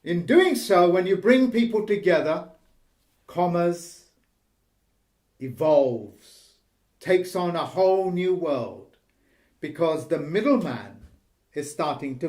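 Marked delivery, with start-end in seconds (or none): none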